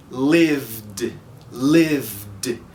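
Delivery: "Lived" going straight from v to d, no vowel; "Lived" drilled as one syllable. Shown as a demonstration. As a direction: slide "Lived" from v into d. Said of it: "vai diretamente do som do V pro som do D".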